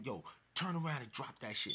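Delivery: ordinary delivery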